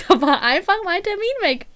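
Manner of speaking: giggling retelling of the lost appointment; laughing disbelief. Retold or imitated. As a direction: laugh out the outrage again. Repeats the punchline, laughing.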